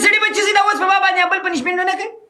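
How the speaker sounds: high, fast shouted quote. Retold or imitated. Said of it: "वाला कहते".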